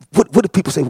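normal talking voice

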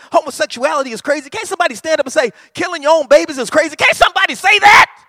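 fast, loud, building preacher cadence that peaks in a shout; fiery pulpit crescendo; preaching at full force. Real, not acted. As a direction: drive each question harder until it's a shout.

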